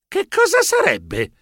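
high, slow baffled question; perplexed. Acted. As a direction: stare at the costume, puzzled. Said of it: "chiesi perplesso".

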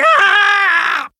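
loud, high scream of pain; long high-pitched "aah". Acted. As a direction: yell out as the massage hurts. Narrator hurt by rough massage.

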